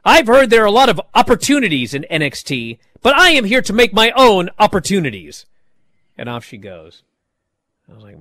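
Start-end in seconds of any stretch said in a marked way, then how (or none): 0.0-5.5 higher, louder, overly formal wrestler promo; stiff scripted promo voice. Retold or imitated. Podcaster mocking Dana Brooke's robotic ring promo, stiff and overdone. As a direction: recite it like a stilted scripted promo. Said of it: "incapable of talking like a normal human being".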